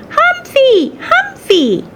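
put-on very high little-boy shout; toddler shouting. Toddler rushing to the hamster's table, high-pitched shout. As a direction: shout it in a squealing small child's voice.

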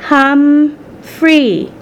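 high, drawn-out learner's attempt; halting attempt. Frightened mother trying an English name, slow and halting. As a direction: sound out each syllable carefully. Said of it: "but she tried".

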